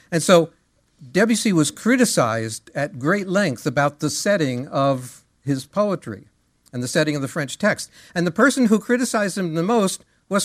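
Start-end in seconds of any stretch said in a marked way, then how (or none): none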